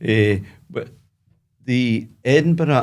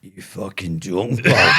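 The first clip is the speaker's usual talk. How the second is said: throaty Glasgow hard man, low then barked; 3 semitones lower than usual. Shown as a demonstration. Demonstrating the harsh Glasgow accent, throaty and hard. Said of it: "Glasgow's hard at the back of the throat".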